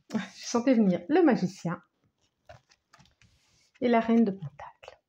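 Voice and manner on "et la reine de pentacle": ordinary talk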